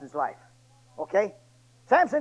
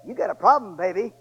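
full-force preaching; 5 semitones higher, 12 dB louder than usual. Acted. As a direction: thunder it from the pulpit. Preacher exhorting the congregation.

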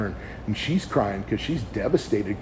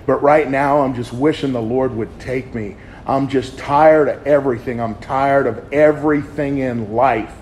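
raised voice pouring out despair, louder; emotional outpouring; 4 semitones higher, 8 dB louder than usual. Retold or imitated. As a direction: let it all spill out to your wife, loud and heavy. And I unloaded.

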